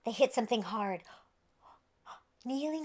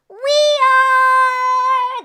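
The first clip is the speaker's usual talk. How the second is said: high, loud excited shout; a big high cheer; 13 semitones higher, 17 dB louder than usual. Acted. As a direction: yell it together. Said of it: "they all shouted at once".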